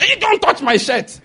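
high-pitched startled "hey"; acted-out panicky refusal; alarmed warning. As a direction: recoil from a stranger's touch, voice shooting way up. Acted alarm at stranger's touch.

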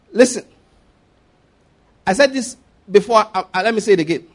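ordinary talk

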